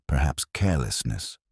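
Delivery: plain reading voice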